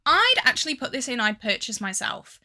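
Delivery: ordinary delivery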